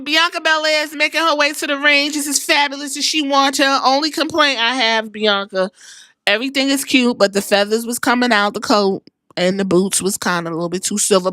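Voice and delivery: usual speaking voice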